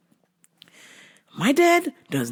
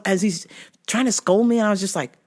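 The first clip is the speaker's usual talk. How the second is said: normal talking voice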